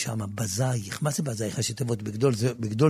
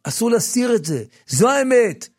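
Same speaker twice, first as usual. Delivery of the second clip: impassioned preaching; 8 semitones higher, 8 dB louder than usual. Acted. Preacher praising his rabbi who cured his stutter, loud. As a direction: pour out gratitude to the teacher. Emotional testimony, raised.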